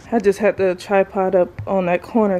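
ordinary talk

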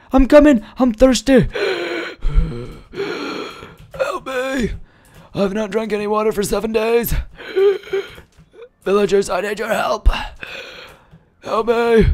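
high, hammed-up plea between gasps; parched traveller. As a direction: gasp for help in mock desperation. Plays parched traveller seeking help.

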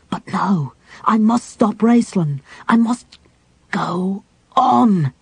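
strained, determined warrior voice. Acted. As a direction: push the words through the fear. Caramon forces himself on.

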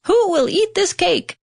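high, eager hen's question; hen crying out. A narrator's reading. Hen asking the lazy animals for help, high call. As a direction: call out brightly as the little hen. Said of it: "asked the little red hen".

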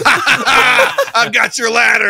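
high, loud, drawn-out mock taunt; gloating taunt. Prankster behind a locked door, gloating. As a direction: imitate a prankster crowing slowly.

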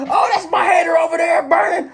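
high-pitched, gleeful play-acted call; mock thrilled shout. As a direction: call out from a roller coaster, hammed-up. Imagines spotting hater from roller coaster.

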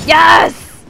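loud high yes; triumphant shout. Real, not acted. Wins fight.

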